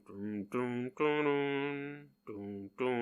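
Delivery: soft, low hummed echo of a riff; quiet hummed riff. Retold or imitated. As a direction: hum it back softly like an echo. Orb echoing the guitar.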